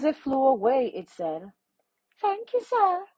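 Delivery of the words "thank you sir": very high little bee voice; tiny voice. A narrator's reading. Bee thanking the man as it flies away, tiny high voice. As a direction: pipe it up like a tiny insect. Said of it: "A tiny voice said".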